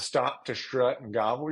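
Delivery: normal talking voice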